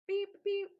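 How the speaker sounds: loud high imitated car horn; playful beep beep. A narrator's reading. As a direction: mimic a honking horn. Benjamin punching the horn.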